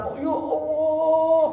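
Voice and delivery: high, slow, drawn-out wail of ya Allah; mock tearful prayer. Speaker mocking a prayer leader crying in front of people. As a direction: ham up a weepy public prayer.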